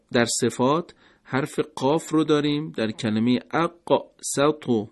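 normal talking voice

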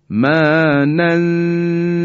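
slow chanted ما ننسخ with nun hidden before sin; Quran recitation. Shown as a demonstration. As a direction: recite ما ننسخ slowly, nasal hum into the س.

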